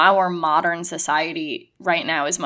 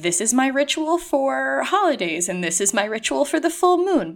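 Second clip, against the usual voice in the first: a lightly pitched-up, overly formal recital of rituals; mock-solemn over-explaining; 7 semitones higher than usual. To family, lightly mocking an over-detailed ritual explanation. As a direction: parody someone laying out every ritual in tedious detail.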